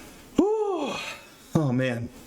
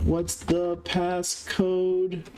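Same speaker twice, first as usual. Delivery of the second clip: very slow, higher, stretched-out words; drawn-out singsong; 4 semitones higher, much slower than usual. Pretend. Streamer waiting on the passcode, drawn-out singsong. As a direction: stretch every word out idly. Playfully repeating question while waiting.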